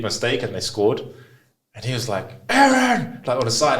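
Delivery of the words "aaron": sharp yell from the sideline; angry coach shouting a name. Retold or imitated. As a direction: call him out loud and high.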